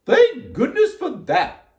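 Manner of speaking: high, loud, slow lady's voice; Miss Rogers. A narrator's reading. Miss Rogers receives the tea, high exclaiming voice. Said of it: "said Miss Rogers".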